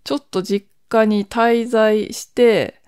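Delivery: usual speaking voice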